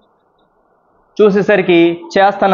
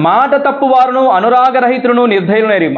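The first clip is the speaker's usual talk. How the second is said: raised Bible reading voice; scripture reading; 5 semitones higher than usual. Acted. Pastor rereading verse thirty-one to the church, raised. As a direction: read the verse out again clearly.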